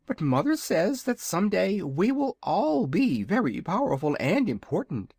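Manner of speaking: high, proud little dragon voice; a boastful baby dragon, pitched high; young dragonette. Acted. Dragonette speaks to visitors.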